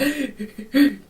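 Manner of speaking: gasping sobs, acted out; mock hysterical crying. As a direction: imitate gulping, hysterical sobs. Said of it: "like, hysterically crying".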